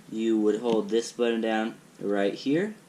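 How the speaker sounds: ordinary talk, nothing marked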